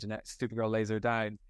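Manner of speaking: ordinary talk, nothing marked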